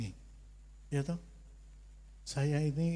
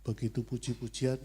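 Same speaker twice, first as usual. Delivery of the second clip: usual speaking voice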